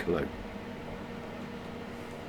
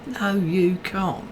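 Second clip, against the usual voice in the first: slow, higher sarcastic put-down; mocking retort; 9 semitones higher, slower than usual. Scoffing at a piano app advert, mocking. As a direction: tease the ad's claim.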